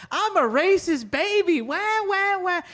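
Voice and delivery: high, slow baby voice with mock crying. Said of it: "baby racist routine".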